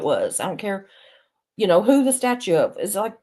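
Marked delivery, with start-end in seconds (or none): none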